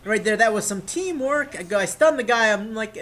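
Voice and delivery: usual speaking voice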